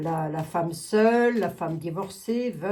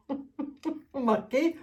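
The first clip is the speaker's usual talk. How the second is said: laughing; 7 semitones higher, much slower than usual. Real, not acted. Laughs at own prediction.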